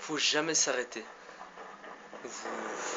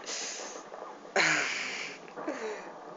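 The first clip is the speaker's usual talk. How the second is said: rueful laugh; 3 semitones higher than usual. Real, not acted. Laugh after being killed.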